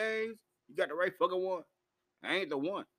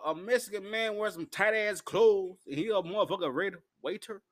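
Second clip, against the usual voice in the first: ordinary talk, nothing marked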